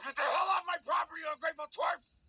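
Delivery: high, fast angry yell; furious high-pitched rant; angry homeowner. Acted. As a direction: chase the kids off your property. Angry homeowner chases off trick-or-treaters.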